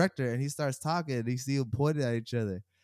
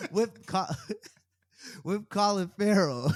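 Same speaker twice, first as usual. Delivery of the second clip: real laughter breaking into slow words; higher, halting, laughing delivery; cracking up; 6 semitones higher, slower than usual. Real, not acted. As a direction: try to get the name out while laughing. Cracking up at own story.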